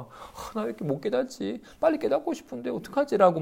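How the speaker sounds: high, fretful worry over not awakening; anxious fretting. Retold or imitated. A student fretting to himself about awakening, high and anxious. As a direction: fret like a student stuck on enlightenment. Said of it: "불안한 마음이 들었나 봐요".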